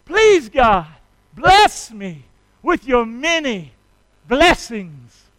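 loud, slow, fervent prayer; leading the church in a repeated prayer. Shown as a demonstration. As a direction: draw out each word for them to repeat. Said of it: "start praying like this".

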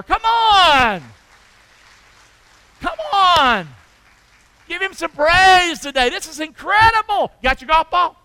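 loud, high call to praise; full-force preaching. Acted. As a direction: rouse the crowd to praise.

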